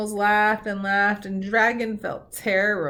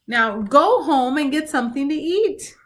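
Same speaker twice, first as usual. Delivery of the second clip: louder scolding order; angry squirrel; 5 semitones higher, 5 dB louder than usual. Acted. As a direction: shoo him home crossly. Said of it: "cried the angry squirrel".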